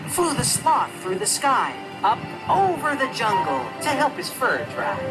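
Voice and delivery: ordinary delivery, nothing marked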